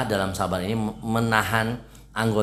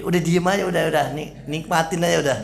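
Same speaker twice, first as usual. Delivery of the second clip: higher, louder brush-off; mock hushing; 8 semitones higher, 4 dB louder than usual. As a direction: shush a grumbler in a raised voice. Acted hushing of a grumbler.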